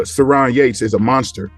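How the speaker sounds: ordinary delivery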